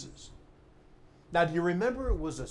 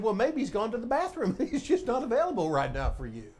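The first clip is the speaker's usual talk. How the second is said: sarcastic; 8 semitones higher, faster than usual. Acted. Preacher as Elijah taunting Baal's prophets, sarcastic. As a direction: toss out a mock excuse with a chuckle. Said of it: "he got really sarcastic".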